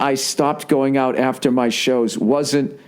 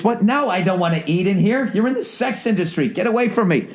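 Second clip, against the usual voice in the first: higher-pitched, put-upon protest; indignant refusal; 5 semitones higher than usual. Acted. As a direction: act out a put-off customer's refusal. Acts out rejecting food in strip club.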